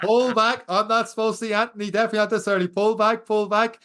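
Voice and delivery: high, hurried self-warning; urgent self-talk. Retold or imitated. Urging himself mid-race.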